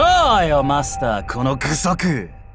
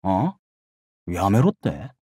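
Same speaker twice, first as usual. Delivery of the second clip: displeased challenge; 11 semitones lower, 4 dB quieter, slower than usual. Acted. Bristles at being told to stop.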